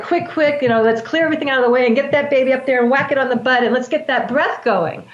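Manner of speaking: fast, hurried retelling of staff urgency; mock hospital rush. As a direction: rattle it off like a rushed nurse. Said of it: "it's very much like".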